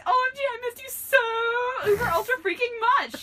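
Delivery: very high, loud squeal; shouted gushing. A narrator's reading. Girl greeting a missed friend, gushing shout. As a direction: gush it at a hammed-up shriek. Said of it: "she shouted".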